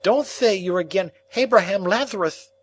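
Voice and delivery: high, loud pleading with a lisp; frightened client pleading with a lisp; alarmed lisping plea. Acted. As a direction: beg him in alarm, lisping.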